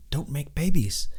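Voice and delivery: normal talking voice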